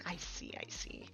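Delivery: ordinary delivery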